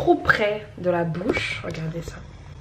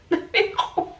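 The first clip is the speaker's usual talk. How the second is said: high whimpering whisper; 13 semitones higher than usual. Retold or imitated. Retelling pain at the dentist.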